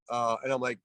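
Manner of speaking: normal talking voice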